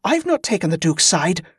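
high, urgent hissed plea. A narrator's reading. Ali explaining herself to her brother, hissed. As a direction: plead urgently under pressure. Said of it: "Ali hissed".

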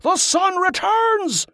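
very high, slow exultant cry; roared with joy. A narrator's reading. Liam throws his head back, roaring with righteous joy. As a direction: proclaim it to the heavens, drawn out. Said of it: "hurling his voice into the sky".

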